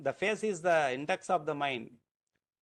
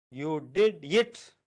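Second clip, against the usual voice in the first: IT set apart and punched after "did"; stress on IT; 4 dB louder, slower than usual. Shown as a demonstration. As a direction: slowly separate and hit the word "it". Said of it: "emphasis that I am giving".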